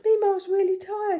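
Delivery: put-on high, loud little-boy voice; child voice. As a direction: play the toddler announcing he's done. Grandson declaring he's tired.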